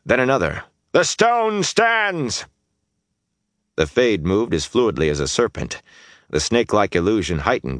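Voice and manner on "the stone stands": high, drawn-out shout; echoed battle cry. Acted. Defenders echo Rand's rallying call, shouting it high. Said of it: "he heard someone echo him".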